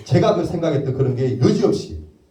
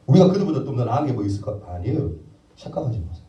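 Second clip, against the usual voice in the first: ordinary talk, nothing marked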